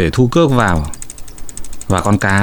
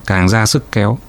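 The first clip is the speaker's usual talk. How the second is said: ordinary delivery, nothing marked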